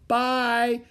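high, drawn-out goodbye call; cried farewell. A narrator's reading. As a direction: call goodbye across a distance. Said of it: "cried Annie".

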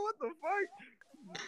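high, drawn-out shocked outcry. Real, not acted. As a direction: stretch it out high in disbelief. Shocked at friend's wording.

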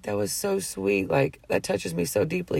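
softly retold, moved thanks; hushed, lightly emotional quote; touched, near tears. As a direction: imitate a man quietly overcome with gratitude. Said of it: "got tears in his eyes".

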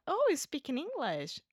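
mock "oh, really?" surprise; retold surprise. Officer finding out the traveler speaks English, retold surprise.

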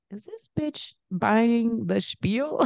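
slow, drawn-out disbelief; a slow, mocking inner thought; incredulous aside. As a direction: let the doubt stretch each word.

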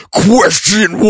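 slow, drawn-out, processed-sounding announcer; fake voice effect. Shown as a demonstration. Host faking a voice effect for the quiz. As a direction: stretch it out like an edited-in effect. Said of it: "Should I do a fake, a fake voice effect".

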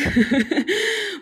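high loud laugh. Real, not acted. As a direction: burst out laughing. Laughs mid-sentence.

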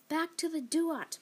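high, loud, slow command; roar. Acted. Father roaring at the fiery spirit: high, loud, slow. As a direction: bellow to banish the spirit. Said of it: "my father roared".

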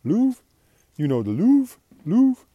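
put-on French accent, "Louvre" rising high and loud; imitated French guy. French guy prompting the CEO, loud mock French accent. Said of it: "French guy says".

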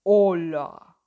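loud, deep dragon greeting. A narrator's reading. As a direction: boom the hello like a dragon. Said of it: "dijo el dragón".

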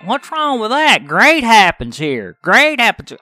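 mock defensive voice. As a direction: parrot the naive defenders. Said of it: "and everybody's".

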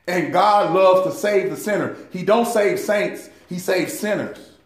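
ordinary talk

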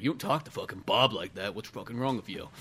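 low, soft menacing growl; mock gruff bartender. Friend voicing the angry bartender, low and menacing.

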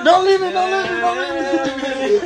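high pleading voice. Pretend. High far pleading bit.

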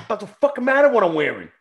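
normal talking voice

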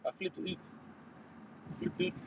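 softly put-on Canadian "out"; Canadian accent. Joking about how [name] would say it, Canadian accent. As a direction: say it like a Canadian, quietly. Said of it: "like a [name] would say".

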